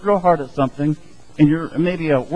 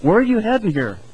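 puzzled question; 7 semitones higher than usual. Retold or imitated. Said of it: "You go".

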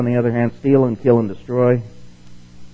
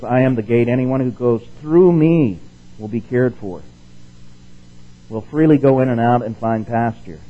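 scripture reading; 4 dB louder than usual. Acted. Preacher reading the Message to the class, louder proclamation. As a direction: proclaim the verse.

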